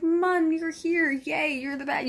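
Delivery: a fan voicing the gang's cheer; excited greeting. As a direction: welcome him like a hero. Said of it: "everyone's all like".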